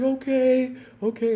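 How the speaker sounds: high, small okay; shaky agreement. Retold or imitated. Woman in the water to officer, high and small. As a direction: answer like a scared woman.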